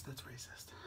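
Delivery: unvoiced whisper. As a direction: whisper it as a joke. Whispered joke aside.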